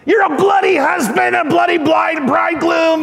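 high-pitched acted tirade; hammed-up furious yell, very high; yelling wife. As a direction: screech it at the husband. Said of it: "she yells at him".

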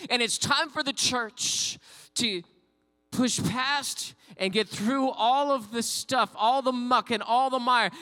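loud, rising preacher's climax, high and driving; preaching at full force. Acted.

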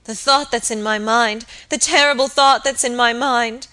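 loud, agitated, pitch climbing; horrified dread. A narrator's reading. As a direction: voice a terrible dread. Horrified maid voices her dread.

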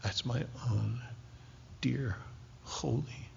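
hushed reverent whisper; hushed voice of God. Acted. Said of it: "And God says".

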